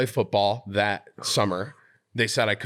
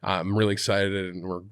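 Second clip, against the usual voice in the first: usual speaking voice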